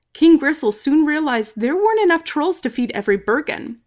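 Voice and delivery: usual speaking voice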